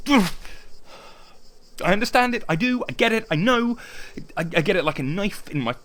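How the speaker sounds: anguished outburst. Acted. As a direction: wail it from wounded pride.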